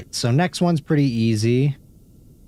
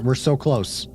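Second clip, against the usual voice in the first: ordinary talk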